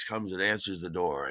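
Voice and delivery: ordinary talk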